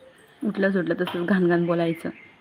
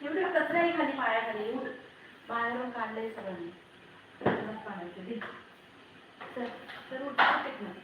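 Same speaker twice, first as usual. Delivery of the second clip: higher, softer coaxing murmur; 5 semitones higher, 10 dB quieter than usual. Real, not acted. Mother murmuring softly to her toddler about the heat, higher and softer.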